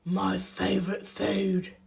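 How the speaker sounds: lightly roughened monster voice; Gruffalo. Acted. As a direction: play a hungry monster sizing up a meal. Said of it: "the Gruffalo said".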